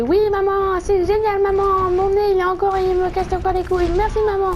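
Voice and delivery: high, loud, fake-enthusiastic kid voice; sarcastic sugary thanks to mum; mock sweet reply. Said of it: "J'ai fait". (fils faussement enthousiaste, voix aiguë et forte)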